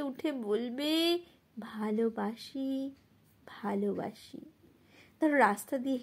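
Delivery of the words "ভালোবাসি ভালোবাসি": tender reply. Acted. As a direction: answer tenderly, sinking softer on the repeat. Tender answer in the poem's refrain. (কোমল, নিচু স্বরে)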